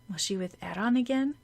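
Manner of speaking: ordinary delivery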